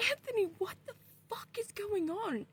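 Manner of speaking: high-pitched, dramatic confusion; retold bewildered demand.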